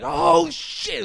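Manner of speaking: high, loud hammed-up yelp; a robber jolted by a bang; loud high startled cry; mock startled yelp. Robber reacts to dye pack.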